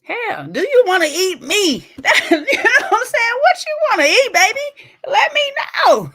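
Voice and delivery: high, loud, laughing sweet-talk; mock flirty offer. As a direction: coax him with food like a hammed-up sweetheart. Teasingly offering a hungry man food.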